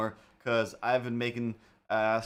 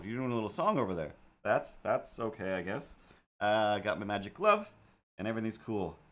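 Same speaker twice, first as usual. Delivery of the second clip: ordinary talk, nothing marked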